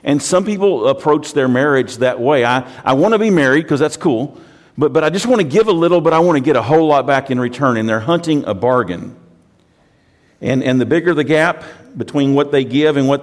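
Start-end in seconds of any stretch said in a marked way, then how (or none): 2.4-7.8 mock greedy whine, a bit higher and louder; selfish spouse. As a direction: play the grabby spouse who wants it all.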